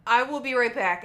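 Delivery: loud, firm, hurried; urgent announcement. Retold or imitated. Coworker running to get the manager, loud and urgent.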